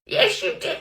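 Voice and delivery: ordinary talk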